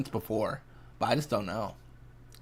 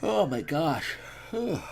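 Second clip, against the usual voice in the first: yawning; 5 semitones higher, slower than usual. Real, not acted. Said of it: "don't know why I'm yawning".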